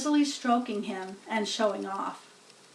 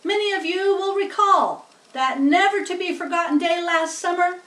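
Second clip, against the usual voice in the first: somewhat higher, louder PA speech; lightly pompous announcer; 7 semitones higher, 6 dB louder than usual. Acted. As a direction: proclaim it to the crowd. Loudspeaker announcement continues.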